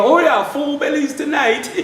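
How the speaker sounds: slow, high, happy promise; cheerful old man. Acted. Old father promising fish to the men, slow and high. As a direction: savour it like a grinning elder.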